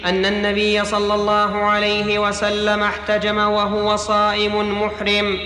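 ordinary talk, nothing marked

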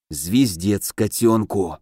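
low, slow, creaking voice; creaky curse. A narrator's reading. Ally sees the army and curses, creaking. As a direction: squeeze it out in a creak. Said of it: "проскрипел Алёша изо всех сил".

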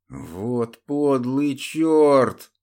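громко и медленно прорычать; прорычать со злостью; злобный рык. Acted. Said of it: "прорычал Мирон". (злобное рычание)

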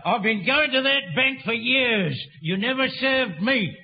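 usual speaking voice

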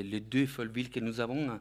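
ordinary talk

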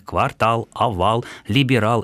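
квартал, овал, либерал each stressed on the final -ал; final-syllable stress. Shown as a demonstration. Listing French loans as examples, квартал, овал, либерал with final stress. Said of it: "ударение будет ставиться только на последний слог".

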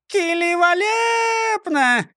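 put-on high, slow childish shout; a tiny girl yelling in delight. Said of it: "прокричала Клара". (детский радостный крик)

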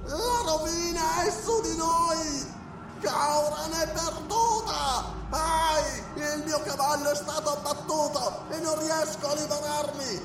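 wailing priest. Acted. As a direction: wail out the ruin, half singing. Wounded priest wails.

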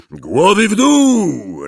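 high, drawn-out warning yell; roared. A narrator's reading. Hagrid warning boats nearing the cliff, roaring. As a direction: bellow a warning to everyone. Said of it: "ryknął Hagrid".

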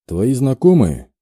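plain reading voice

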